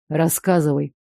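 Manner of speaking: lightly voiced man, low and level; demanding. A narrator's reading. Fiance demanding the story, low terse order. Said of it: "потребовал Илья".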